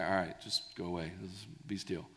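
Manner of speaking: soft, low, casual aside; offhand. Retold or imitated. Jesus casually dismissing the storm, soft and offhand. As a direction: toss it off quietly, as no big deal.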